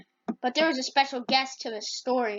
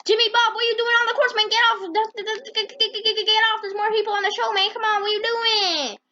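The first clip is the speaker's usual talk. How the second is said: a much higher, cartoonish voice chasing someone off the course; high-pitched yelling character; 8 semitones higher than usual. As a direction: shoo him away in a squeaky toon voice. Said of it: "they're like".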